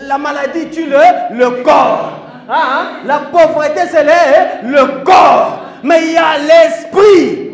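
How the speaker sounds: loud, rising preacher's cry; fiery preaching. Acted. Preacher shouting to the congregation about the body, loud and fervent. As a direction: call it out to the whole church.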